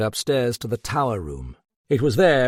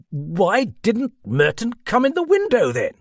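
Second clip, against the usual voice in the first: loud, high incredulous question; agitated high-pitched cry; exasperated demand; 11 semitones higher, 5 dB louder than usual. A narrator's reading. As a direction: fire the challenge back, voice raised. Sir John exasperated, demands answer.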